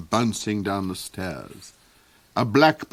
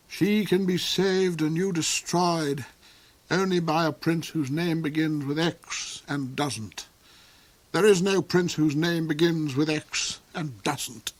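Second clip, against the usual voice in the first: the Duke quoting the witch, higher; mock witch's pronouncement; 7 semitones higher than usual. The Duke recites the witch's spell to Hark, lightly intoned. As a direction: intone the curse a little higher. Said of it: "Like this".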